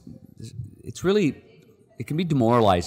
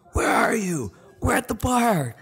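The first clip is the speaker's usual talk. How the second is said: much higher, rowdy mimic of bar buddies; buddies on the phone; slower than usual. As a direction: play the guys yelling down the line.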